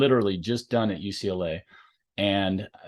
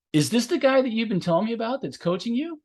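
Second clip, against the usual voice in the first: teasing colleague; 12 semitones higher than usual. Retold or imitated. Colleague teasing about the coach.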